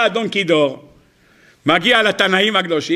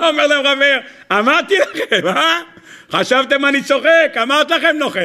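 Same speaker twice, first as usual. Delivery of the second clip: gloating laugh; 7 semitones higher than usual. Acted. Gloating Rabbi Meir, laughing.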